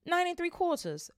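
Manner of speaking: put-on high piping child; piped. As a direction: pipe it up like a small girl. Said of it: "piped a small girl".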